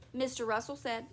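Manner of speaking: ordinary delivery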